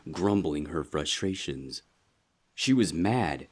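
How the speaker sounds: ordinary delivery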